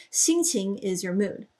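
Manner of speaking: ordinary talk, nothing marked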